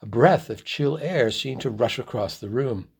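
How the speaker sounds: plain reading voice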